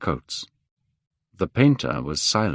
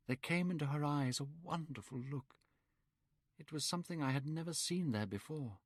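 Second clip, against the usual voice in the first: put-on higher, hushed, wondering young man; young Dorian, dreamy; 6 semitones higher, 6 dB quieter than usual. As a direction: recall the kiss in awe. Dorian recounts his love.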